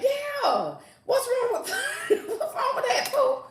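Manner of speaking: squeaky high-pitched laughing tease; high squeal. Real, not acted. Teasing Pooh about five things.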